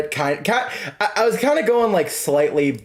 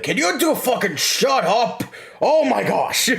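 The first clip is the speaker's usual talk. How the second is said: exasperated captain; 9 semitones higher, slower than usual. Retold or imitated. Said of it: "I was also gonna be like".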